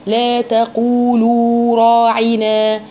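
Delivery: تقولوا with both long vowels held equally; two natural madds. Shown as a demonstration. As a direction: draw out تقو and لو each two beats. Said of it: "تقولوا فيها مدين طبيعيين".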